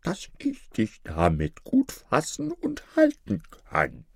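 the wolf keeping up the grandmother act; wolf pretending to be the grandmother.

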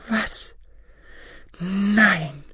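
high-pitched exclamations with pauses between; astonished wife. Acted. Wife hears about shrivelled apples, high and astonished.

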